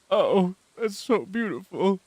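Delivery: lightly voiced sappy sob-gush; mock weepy gush. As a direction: gush like a teary patient. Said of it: "I was like".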